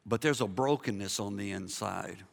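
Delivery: low, soft consoling voice; tender. Real, not acted. Preacher to hurting churchgoers, tender and hushed. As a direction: drop low and gently name the hurt.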